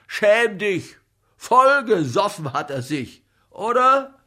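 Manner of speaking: loud, high reproach; accusing outburst ending on a high challenge; indignant scolding. A narrator's reading. Comic accuses him of drinking.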